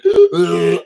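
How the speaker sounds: a loud, rasping gasp for air; gasping breath that sounds like a donkey. Real, not acted. Choking on own breath on stream, loud gasp. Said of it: "it sounded like a donkey".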